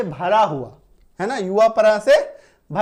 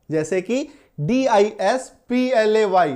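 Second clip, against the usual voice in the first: ordinary talk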